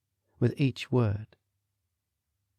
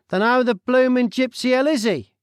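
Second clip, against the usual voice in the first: brash Cockney man, higher and louder; nosy Cockney stranger butting in; loud, cheeky Cockney voice; Cockney accent; 12 semitones higher, 6 dB louder than usual. Acted. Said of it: "said the Cockney man".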